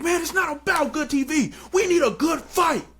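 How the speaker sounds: high, exasperated scolding; fuming CEO. Retold or imitated. Angry CEO scolding the producer, high pitched. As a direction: set him straight about the fight. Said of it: "he was like".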